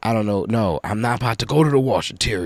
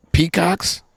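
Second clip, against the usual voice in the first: high, disbelieving rise on peacocks; incredulous question; 11 semitones higher, 3 dB louder than usual. Real, not acted. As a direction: ask it like you can't believe it. Can't believe there are peacocks.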